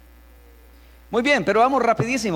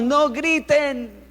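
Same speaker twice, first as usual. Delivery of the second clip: higher, drawn-out shouted command; mock parental yell; 7 semitones higher, much slower than usual. Parent ordering children not to shout, mock yelling. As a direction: yell it as a parent, stretching the words. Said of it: "se lo decimos gritando".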